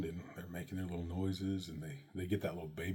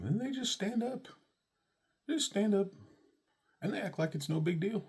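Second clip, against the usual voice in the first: high, amazed telling of the big moment; 14 semitones higher, 4 dB louder than usual. Retold or imitated. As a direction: squeal it like you can't believe it. Baby suddenly stands, amazed retelling.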